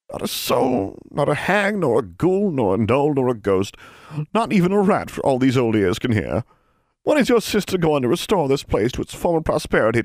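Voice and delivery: put-on high, creaky old crone calling out. A narrator's reading. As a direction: call into the dark like an old crone. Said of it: "called a creaky old voice".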